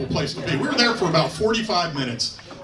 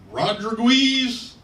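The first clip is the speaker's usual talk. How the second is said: slow, higher questioning roll call; struggling to read; 5 semitones higher, much slower than usual. Acted. Clipboard guy struggling through a recruit's name, hesitant. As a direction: sound out the surname uncertainly, rising. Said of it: "kind of struggling with some of 'em".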